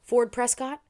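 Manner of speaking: lightly voiced high, sharp query; sharp, high-pitched. A narrator's reading. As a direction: call the name out high and sharp. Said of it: "A sharp, high-pitched voice".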